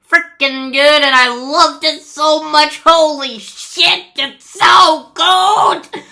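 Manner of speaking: loud, high burst of gushing; excited raving. Real, not acted. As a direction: rave at full volume, stretching the last words. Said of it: "I can't stop raving about this movie".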